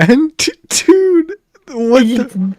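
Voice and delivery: high, loud disbelief; shocked. Real, not acted. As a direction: react in shock at something gross. Shock at tea bag in mouth.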